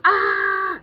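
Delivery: high, loud sudden shout at a dog; mock yell. A kid yelling at the dog, loud high shout. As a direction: bark it out to scare the dog.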